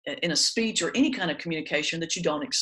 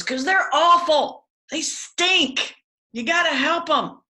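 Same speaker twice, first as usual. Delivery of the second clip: imitating an old man's much higher, louder yell; 10 semitones higher, 6 dB louder, slower than usual. Pastor retells Charlie heckling the prayer about the Mets, a much higher, louder mock yell. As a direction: shout it across the church like a deaf old fan. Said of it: "and he goes".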